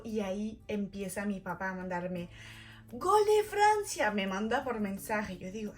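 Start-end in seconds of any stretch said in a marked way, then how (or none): 2.9-4.1 high, loud, drawn-out goal cry; imitated goal cheer. Dad texting her the goal, mock jubilant shout. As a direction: celebrate slowly and loudly. Dad's goal message, acted.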